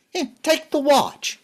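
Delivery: high, pushed-out insistence; angry, high-pitched giving up of the watch; exasperated offer. Acted. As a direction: shove it at him with your voice. Thrusting the watch at him.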